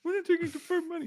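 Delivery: mocking quote.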